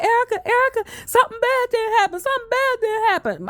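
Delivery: high, strained and trembling father voice; father on the phone telling his daughter, high and shaken. Retold or imitated. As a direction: sound like a frightened man trying to hold it together. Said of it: "I never hear my father talk like that".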